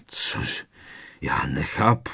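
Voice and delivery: low, hoarse bewilderment. A narrator's reading. Strýc Vernon cannot understand the letter, hoarse. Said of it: "zeptal se chraptivě strýc Vernon".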